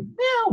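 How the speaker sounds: very high, thin meow; cat meow. Retold or imitated. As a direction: mew like a small stray cat. Imitating a stray cat.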